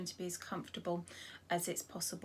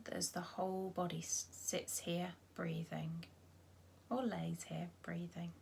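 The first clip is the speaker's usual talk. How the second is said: gentle meditation voice with long pauses; guided body scan. Acted. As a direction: guide them unhurriedly through the body, leaving space after each phrase.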